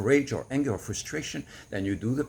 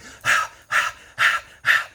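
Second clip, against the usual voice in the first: forceful breathy sigh pushed out loud; loud fire breath exhale; 8 dB louder than usual. Shown as a demonstration. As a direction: blow the breath out hard like releasing fire. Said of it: "It's going to be like".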